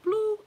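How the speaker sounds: high squeaky bloop, loud; a cartoon beep, way up high; register bloop. Cashier's register ringing up items, a high loud bloop. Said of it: "She's like".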